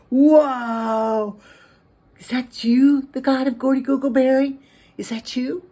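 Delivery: high, loud amazed cry; startled and booming with wonder; astonished shout. Acted. Taylor thinks God has answered, astonished shout.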